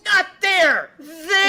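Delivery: high, loud frantic shouting, hammed up; mock frantic yell. Retelling the arm scene to friends, high mock frantic yell. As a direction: yell it like someone's sawing off your arm. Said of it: "he's like".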